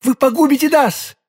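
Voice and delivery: high, panicked warning; desperate cry. A narrator's reading. Lady desperately stops him. (отчаянный испуганный крик)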